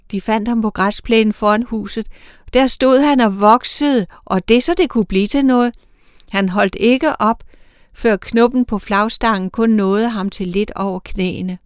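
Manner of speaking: usual speaking voice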